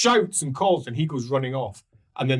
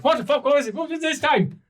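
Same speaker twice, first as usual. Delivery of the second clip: high, loud alarmed guard swearing; guard turning to see what's happened, high and loud; 13 semitones higher, 3 dB louder, faster than usual. Acted. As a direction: spin round in alarm. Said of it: "As he goes".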